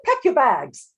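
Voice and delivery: loud, high princess dismissal; haughty, forceful order; imperious cry. A narrator's reading. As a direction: cry it out like a furious little royal. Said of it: "the princess cried".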